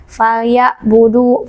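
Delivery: usual speaking voice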